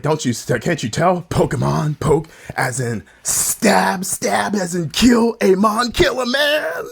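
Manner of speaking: building from indignant to slow, high shout; church lady preaching. Retold or imitated. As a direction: preach the made-up etymology ever harder. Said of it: "It's like".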